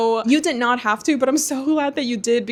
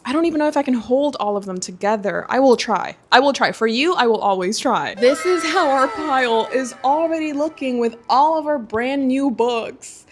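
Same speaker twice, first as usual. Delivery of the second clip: usual speaking voice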